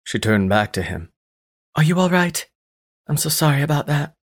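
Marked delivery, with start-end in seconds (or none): 1.8-4.2 worried woman. Acted. Cassini apologizes to date.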